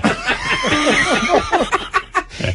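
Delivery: shrieking laughter far up high; real high cackle; high helpless laugh. Real, not acted. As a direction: lose it laughing. Laughing at own story.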